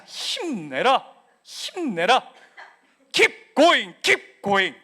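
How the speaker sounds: drawn-out, loud shout of encouragement; rousing cheer. Acted. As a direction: cheer them on at full voice. Cheering the congregation onward.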